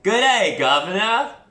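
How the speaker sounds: loud, high, badly faked British accent; really bad British accent. Shown as a demonstration. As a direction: belt out a terrible fake British accent. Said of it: "That was my really bad British accent".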